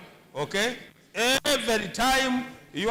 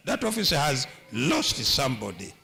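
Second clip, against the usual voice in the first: normal talking voice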